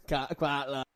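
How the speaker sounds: put-on soft, tentative Scottish accent. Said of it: "I'm trying to do a Scottish accent".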